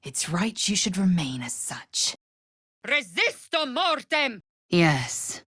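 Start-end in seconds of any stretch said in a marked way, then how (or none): 2.9-4.4 spell incantation. Acted. Casting a spell.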